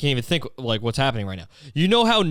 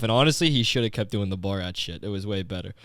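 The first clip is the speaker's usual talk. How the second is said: usual speaking voice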